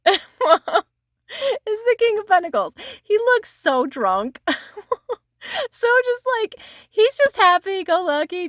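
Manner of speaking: laughing. Real, not acted. Laughing at a funny card.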